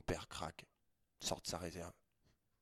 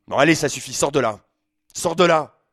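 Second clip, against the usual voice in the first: loud high shouts, building; father shouting at son to get out; father yelling; 8 semitones higher, 16 dB louder than usual. Acted. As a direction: bellow him out of the shower. Father bursts back in.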